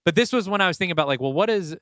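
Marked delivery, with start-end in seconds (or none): none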